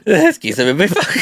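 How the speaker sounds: high, laughing mimicry; laughing echo. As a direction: repeat it through helpless laughter. Echoes the funny line while laughing.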